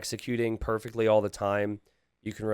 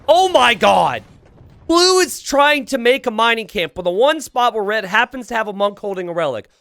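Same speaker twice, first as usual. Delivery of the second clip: much higher and louder, tumbling out fast; excited outburst; 8 semitones higher, 8 dB louder than usual. Real, not acted. Caster reacting to a wild play, excited and loud. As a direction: blurt it like you can't believe your eyes. Sudden surprising play on screen.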